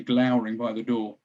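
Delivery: usual speaking voice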